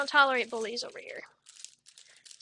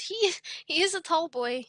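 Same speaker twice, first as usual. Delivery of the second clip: normal talking voice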